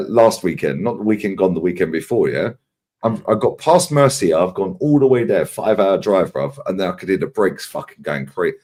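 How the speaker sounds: usual speaking voice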